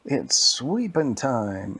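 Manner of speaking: drawn-out, loud broom catchphrase; big slow storybook-character call; broom's catchphrase. Acted. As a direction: announce it slowly and with gusto. Story figure's catchphrase, acted.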